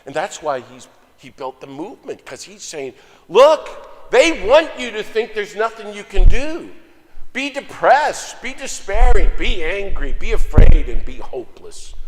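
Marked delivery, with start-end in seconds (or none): 3.3-11.9 loud, high rallying cry; impassioned exhortation. Retold or imitated. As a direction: hammer out each item like a rousing preacher. Quoting a teacher exhorting the oppressed.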